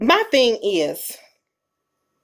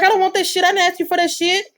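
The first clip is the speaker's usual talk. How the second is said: ungrateful retort; 8 semitones higher, faster than usual. Retold or imitated. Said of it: "And be like".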